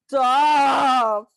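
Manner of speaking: sudden high loud stop; shout. Real, not acted. Shouting at cohost to stop the sad talk, loud. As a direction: cut them off with a yell.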